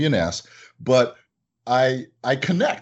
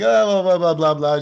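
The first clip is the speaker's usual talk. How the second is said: higher, louder mock blah blah; rattling caller; 6 semitones higher, 6 dB louder than usual. Owner mimics the caller reading her order number.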